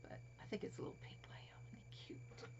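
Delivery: fast hushed whisper; soft delighted whisper. Real, not acted. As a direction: murmur it quickly, barely voiced. Whispering over pink lamb.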